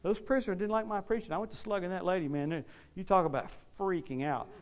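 normal talking voice

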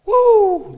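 high, loud "woo"; sudden high holler; loud whoop. Real, not acted. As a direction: let out an excited preacher's whoop. Reads how Peter shouted to crowd.

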